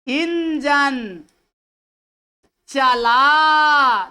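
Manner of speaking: Engine slow, then चला hugely drawn out; stress on चला. Shown as a demonstration. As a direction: stretch and push the whole word चला. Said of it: "पूरा चला पर भार दिया जा रहा है".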